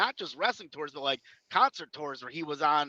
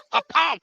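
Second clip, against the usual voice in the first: a higher, laughing impact sound; laughing pa-pow; 11 semitones higher, 5 dB louder than usual. Retold or imitated. Retelling the big splash, a laughing impact noise.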